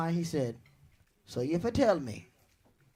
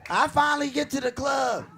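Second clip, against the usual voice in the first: ordinary talk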